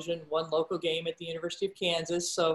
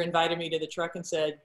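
usual speaking voice

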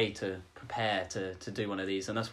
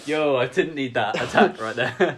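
laughing; 4 semitones higher, 9 dB louder, slower than usual. Real, not acted. Laughing reply to tease.

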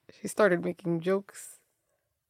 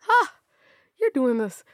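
inner villain; 6 semitones higher than usual. Retold or imitated. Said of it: "the villain in my head is like".

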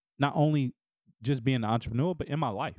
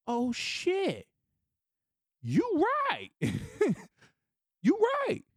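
high, delighted "you right" in mock awe; surprised realization; 11 semitones higher, slower than usual. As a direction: act floored by the compliment, voice jumping up. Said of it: "And I'd be like".

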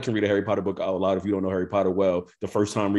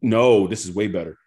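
ordinary talk, nothing marked

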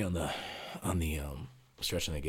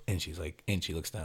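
normal talking voice

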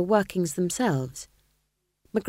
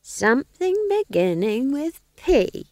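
put-on higher, very slow sing-song old woman; Nanny Og's voice; 8 semitones higher, 3 dB louder, slower than usual. A narrator's reading. Old witch Nanny teasing the ghost king, sing-song. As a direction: drawl out the old witch's party game. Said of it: "said Nanny Og".

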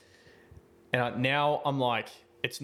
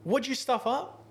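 high-pitched retold telling-off; boss spraying someone; 12 semitones higher than usual. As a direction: imitate a boss demanding an explanation. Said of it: "was spraying him in front of me".

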